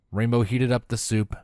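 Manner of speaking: usual speaking voice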